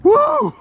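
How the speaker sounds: loud, very high woo; one piercing high victory whoop; celebratory whoop. Real, not acted. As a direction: whoop it up after the catch. Celebrating the catch.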